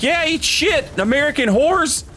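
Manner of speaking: hammed-up, high-pitched jeering; mock trash talk. Mimics Australians trash-talking Americans.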